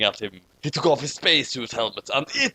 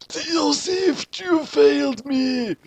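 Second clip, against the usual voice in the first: high, slow mock-furious Kira; hammed-up outraged yell at the failed shinigami; mock scream; 9 semitones higher, slower than usual. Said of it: "he screamed".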